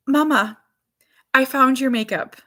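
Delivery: playfully voiced little girl; little daughter's voice. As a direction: mimic a three-year-old announcing a discovery. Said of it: "this is how she talks".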